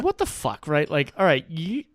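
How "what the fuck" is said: high-pitched retold disbelief; incredulous, pitch jumping up; baffled reaction. Bartender spots the kids' smuggled beer, baffled.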